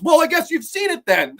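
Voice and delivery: high, hammed-up deadpan; mock Val Kilmer wisecrack. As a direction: toss off the punchline smugly. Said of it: "And he goes".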